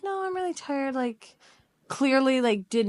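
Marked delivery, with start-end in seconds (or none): 0.0-0.9 put-on girl turning him down. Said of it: "She's like".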